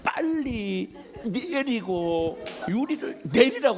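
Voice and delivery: mock the angry worker telling him to roll down the window; angry car wash man. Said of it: "그랬더니 이분이". (화난 세차장 아저씨 흉내)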